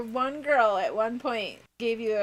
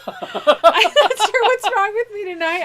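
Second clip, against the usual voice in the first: much louder and higher, laughing fit; giggling helplessly through the words; can't stop laughing; 9 semitones higher, 10 dB louder than usual. Real, not acted. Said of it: "I can't stop".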